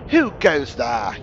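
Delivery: slow, drawn-out sentry challenge. A narrator's reading. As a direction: call out slowly like a sentry. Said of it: "you challenge".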